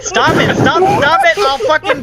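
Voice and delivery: high, loud, laughing fake fury; laughing mock rage. Real, not acted. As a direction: yell through laughter, pretending to be furious. Friends' joke triggers mock outrage.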